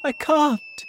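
very high-pitched helpless cry; distressed cry. A narrator's reading. James helpless as the peach falls, distressed cry. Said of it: "cried James".

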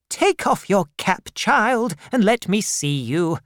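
a severe aunt demanding at the door; stern old aunt. Acted. Miss Betsy voiced.

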